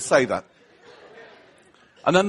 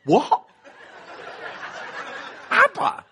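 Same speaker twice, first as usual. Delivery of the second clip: high, incredulous squeak on the name; hammed-up shocked question; astonished; 9 semitones higher, slower than usual. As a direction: react in comic disbelief, pitch leaping up. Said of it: "I was the most surprised".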